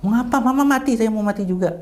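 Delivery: high-pitched grieving outcry; imitating distraught father. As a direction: mimic a despairing wail in words. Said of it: "nangis kenceng". (ratapan tiruan bernada tinggi)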